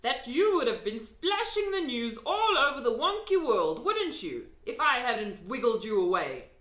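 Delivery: the giant ranting about being caught; lively big friendly giant; excited giant. A narrator's reading. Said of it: "the B F G went on".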